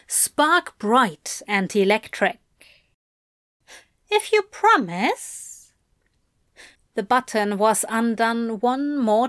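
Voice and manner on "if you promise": higher, playful teasing voice; teasing Aziraphale. A narrator's reading. Aziraphale teasing Crowley in a lilting voice. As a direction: tease him like a coy Santa.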